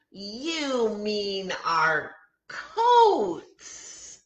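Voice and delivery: put-on children's voices, drawn out, slow; a class correcting their teacher in chorus; slow kids' chorus; kids correcting. A narrator's reading. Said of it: "we shout".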